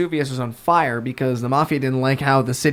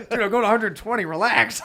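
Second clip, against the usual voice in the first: higher, laughing "relax"; the speeding driver answering, higher with laughter; mock laid-back driver; 7 semitones higher than usual. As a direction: play it breezy and amused.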